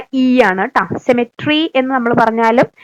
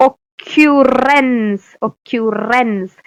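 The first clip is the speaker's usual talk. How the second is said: slow; much slower than usual. Shown as a demonstration. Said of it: "ഈ rinse ഓർക്കുക".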